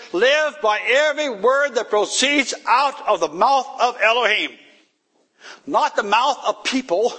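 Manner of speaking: high, loud, fervent preacher's cry; full-force preaching. Acted. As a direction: preach it out to the whole congregation. Preaching to the congregation.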